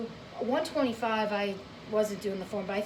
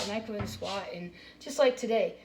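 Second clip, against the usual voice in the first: normal talking voice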